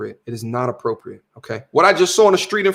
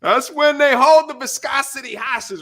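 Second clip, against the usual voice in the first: hammed-up comic quote; 8 semitones higher than usual. Repeating the comedian's line.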